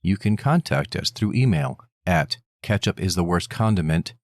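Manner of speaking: low, measured sign-off; podcast outro read. Acted. Host thanks listeners for their time, low outro read. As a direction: read the contact details like an announcer.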